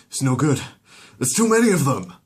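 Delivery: high, loud despair; overwhelmed and losing; desperate cry. Acted. Exterminators giving up the fight, high and desperate.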